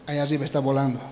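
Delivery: ordinary talk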